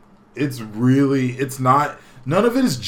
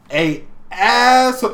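ordinary talk, nothing marked

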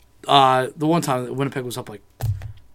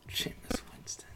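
whisper; 16 dB quieter, slower than usual. Real, not acted. Scornful aside about a player.

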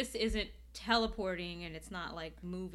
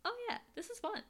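mock delighted; 12 semitones higher than usual. Viewer voicing their reaction on every rewatch, mock delighted. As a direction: act out a small, high-pitched "oh, nice" reaction.

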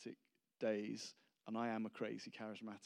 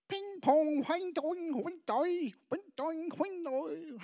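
very high, loud sing-song babble; mock Chinese-sounding tongue; 7 dB louder, slower than usual. Preacher imitates a tongue heard in a meeting, mock Chinese-sounding. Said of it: "the funniest Chinese-sounding tongue".

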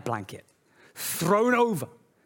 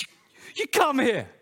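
higher, louder delighted summons; joyful welcoming call; 7 semitones higher, 4 dB louder than usual. Acted. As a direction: call the child in with open arms. God welcoming his child warmly.

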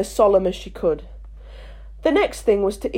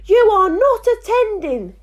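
slow, much higher stern scold; severely; 12 semitones higher, 4 dB louder than usual. A narrator's reading. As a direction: scold sharply and slowly. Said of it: "said the mouse to Alice severely".

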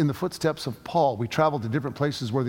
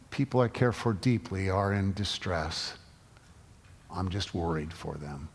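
quiet sorrow; 6 semitones lower, 5 dB quieter than usual. Retold or imitated. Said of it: "tone of sorrow".

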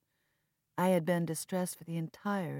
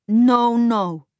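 much louder and higher, a sharp no; firm loud refusal; 8 semitones higher, 18 dB louder than usual. Acted. Viv refusing to arrange the funeral, loud. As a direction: shut him down at once.

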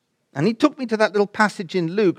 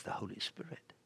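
barely voiced, whispery soft answer; hushed quoted reply; 5 semitones lower, 23 dB quieter than usual. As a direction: imitate a friend's hushed reply. Friend's serene answer, quoted.

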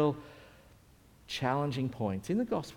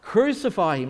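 loud, raised cry of a crowd; 6 semitones higher, 8 dB louder than usual. Acted. Crowd demanding a death from Pilate, loud acted cry. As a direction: call it out as the mob would. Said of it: "what does the crowd say".

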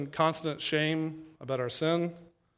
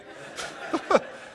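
high breathy laugh; 12 semitones higher than usual. Real, not acted. Laughing at herself.

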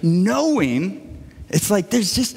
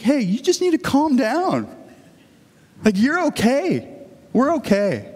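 higher, gentle, reassuring voice; calming uncle; 6 semitones higher than usual. Retold or imitated. Sidney easing his nervous nephew, kind and reassuring. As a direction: settle a jittery kid kindly.

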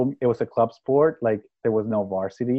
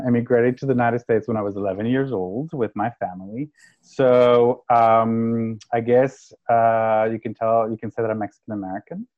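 ordinary talk

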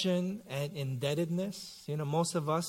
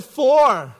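put-on little girl answering; 7 semitones higher, 11 dB louder than usual. Daughter answers dad in story.

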